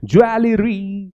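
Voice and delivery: ज्वेलरी drawn out in full syllables; every letter sounded. Shown as a demonstration. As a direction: stretch ज्वेलरी, giving each syllable its sound. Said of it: "हम लोग बोलते हैं".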